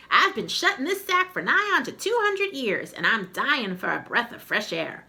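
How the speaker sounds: a creaky skull begging for air; bony old skull, high and pleading; talking skull. Acted. Skull figure speaks.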